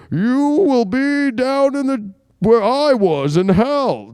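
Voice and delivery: slow, menacing villain voice; Bane impression. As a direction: growl it out like Bane in Batman. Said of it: "he's like".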